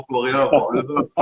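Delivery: laughing through the end of the line; amused laugh at the end. Real, not acted. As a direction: chuckle out the punchline. Laughing at own joke.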